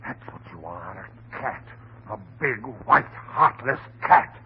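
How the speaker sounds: low, hushed, seething accusation; quietly bitter. Acted. Husband begins bitter accusation.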